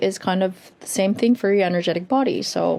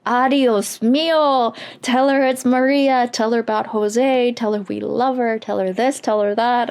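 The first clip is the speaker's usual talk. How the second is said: imitating a loud, urgent spirit, easing slightly; insistent loud voice; 4 semitones higher than usual. Spirit urging the healer to pass on messages, imitated loud voice. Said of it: "this really loud, um, voice".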